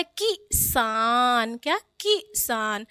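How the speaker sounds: किसान as quick "ki", pause, long "saan"; slow, split "ki... saan" for किसान; short i. Shown as a demonstration. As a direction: cut the first vowel of किसान short, then draw out saan. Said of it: "का पे छोटी लगी है".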